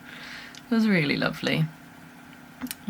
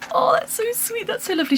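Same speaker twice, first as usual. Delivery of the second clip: high, sweet grandmotherly coo; nan's delight. Retold or imitated. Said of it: "she was like".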